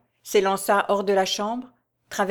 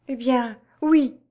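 high, louder giving in; cried out; 5 semitones higher, 4 dB louder, slower than usual. A narrator's reading. Jeanne cries out, giving in to Raoul. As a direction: cry out in surrender. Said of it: "s'écria la jeune femme".

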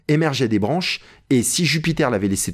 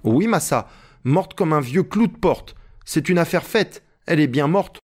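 higher-pitched, lightly voiced character reply; Jupiter, emphatic servant; 6 semitones higher than usual. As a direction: confirm it point by point, pitched up. Narrator voices Jupiter answering. (Jupiter, voix légèrement jouée, plus aiguë)

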